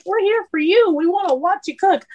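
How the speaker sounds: high-pitched mock-sweet reply; imitated fawning bosses. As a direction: mimic visitors laying it on thick. Acts out visiting bosses' sweet excuse.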